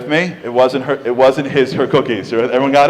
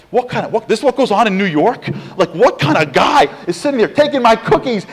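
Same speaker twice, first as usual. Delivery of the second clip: raised, louder indignant outburst; outraged; 7 semitones higher, 5 dB louder than usual. Retold or imitated. Woman furious at stranger eating her cookies, indignant outburst. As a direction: sputter in disbelief at the cookie thief.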